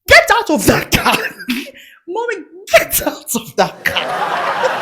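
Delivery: high, stern mock command; mother's order. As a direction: order your kid out of the car. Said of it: "she just wouldn't have it".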